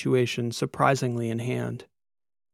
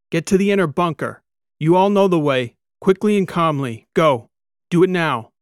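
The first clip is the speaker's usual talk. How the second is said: loud, higher urgent commands; shouted orders; 5 semitones higher, 7 dB louder than usual. A narrator's reading. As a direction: drive the tourists to the bunker. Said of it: "Kikoa shouted".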